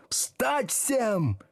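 high, forceful bellow; thundering command. A narrator's reading. Teacher ordering whole class up, high bellow. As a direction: thunder it over the uproar. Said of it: "гремел Слушкин".

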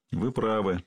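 plain reading voice

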